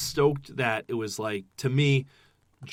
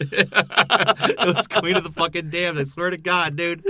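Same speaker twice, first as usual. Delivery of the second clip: laughing agreement; 6 semitones higher, 7 dB louder, slower than usual. Real, not acted. Echoing friend, laughing.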